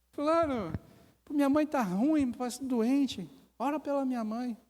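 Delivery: high, imploring put-on voice; pleading. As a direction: imitate someone begging prayer for a relative. Acted plea for sick mother.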